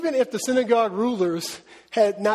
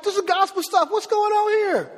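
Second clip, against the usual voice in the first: much higher, slowed wonder; amazed listener; 11 semitones higher than usual. Retold or imitated. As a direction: gasp in disbelief at the news. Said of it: "who is this dude talking like".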